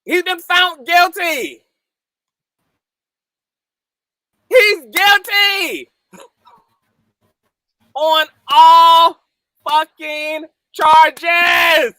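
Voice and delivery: slow, loud, high, every word stretched; triumphant shout. Real, not acted. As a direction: savour each word with triumph. Repeating verdict slowly.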